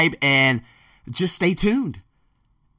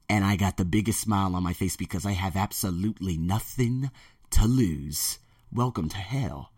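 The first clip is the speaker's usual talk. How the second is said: a quiet villain's promise; vowing revenge on rival schools, low and menacing; 5 semitones lower, 5 dB quieter than usual. Real, not acted. Threatening competitors, low voice.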